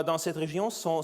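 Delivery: ordinary delivery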